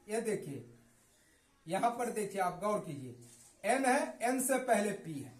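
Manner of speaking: ordinary talk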